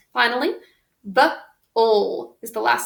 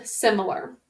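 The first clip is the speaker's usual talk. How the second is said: ordinary talk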